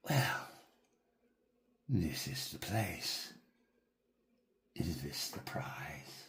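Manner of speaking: low, slow, sinking to a whisper; ominous figure. Acted. Hovering figure looming over the player, sinking to a whisper. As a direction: menace the player from above. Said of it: "he looks down at you and he says".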